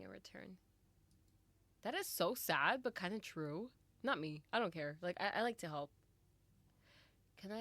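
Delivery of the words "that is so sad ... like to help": much louder, fervent aside; emphatic reaction. Real, not acted. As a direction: declare it passionately. Reacts loudly to story line.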